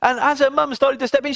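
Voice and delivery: plain reading voice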